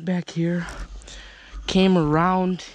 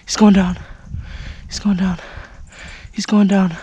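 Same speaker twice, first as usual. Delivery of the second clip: loud, thrilled repeated cry; excited yelling; 3 semitones higher, 6 dB louder, faster than usual. Real, not acted. Hunter watching his shot buck fall, excited shouting.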